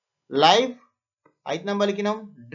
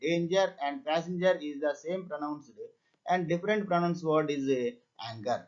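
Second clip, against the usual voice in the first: slow dictation; much slower than usual. Shown as a demonstration. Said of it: "ఈ రెండు same pronunciation".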